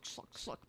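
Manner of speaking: breathy whispered "schluck" sound effect, repeated; whispered slurp noises. Pretend. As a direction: hiss out the slurps like a cartoon sound.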